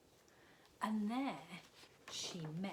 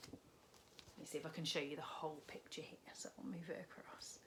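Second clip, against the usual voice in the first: soft unvoiced aside; quiet aside while moving the book; whisper; 8 dB quieter, faster than usual. Real, not acted. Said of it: "whispered aside".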